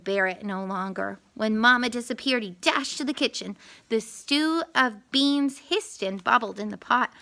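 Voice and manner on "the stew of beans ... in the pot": usual speaking voice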